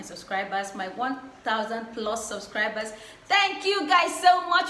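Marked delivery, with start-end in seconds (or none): 3.3-4.7 effusive thanks. Real, not acted. Gushing thanks to subscribers.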